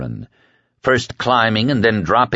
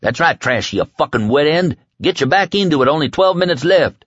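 bullying taskmaster; 7 semitones higher than usual. A narrator's reading. The kid drives Trash to push the bus, goading. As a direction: egg him on like a mean foreman.

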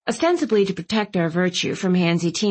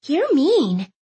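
high pouty whine; pouting; 8 semitones higher than usual. A narrator's reading. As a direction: pout it out, high and hurt. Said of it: "Clove jutted out her lower lip".

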